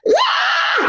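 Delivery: sudden high "Ah!" yelled out; retold scream. Rescue swimmer screaming at a survivor to jump in, high yell. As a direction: yell it to someone in the water. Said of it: "screamed at one of them to jump in".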